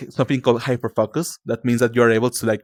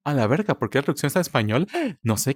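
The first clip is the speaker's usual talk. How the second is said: ordinary talk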